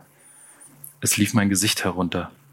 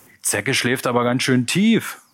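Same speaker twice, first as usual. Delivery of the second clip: higher, mocking call; 4 semitones higher than usual. A narrator's reading. Ringleader jeers at the sleeping punk, calling out. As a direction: call it out for the gang. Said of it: "rief der Typ".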